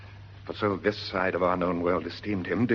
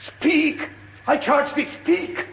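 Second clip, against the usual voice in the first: much higher, loud shouting; desperate shout; 6 dB louder, slower than usual. Acted. Horatio shouting after the departing ghost. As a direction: yell after it as it goes.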